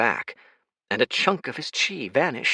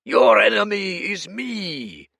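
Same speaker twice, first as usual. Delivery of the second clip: slow, higher, louder roar; roaring challenge; 10 semitones higher, 5 dB louder, slower than usual. Acted. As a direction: challenge the king. Minotaur chieftain challenging.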